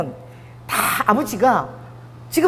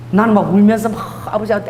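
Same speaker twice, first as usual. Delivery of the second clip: ordinary talk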